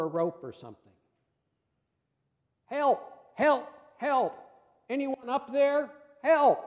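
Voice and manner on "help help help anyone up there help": high, loud cries for help; panicked calls from a dangling man; yelling for help. Acted. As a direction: shout up the cliff, desperate. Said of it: "Jack began yelling for help".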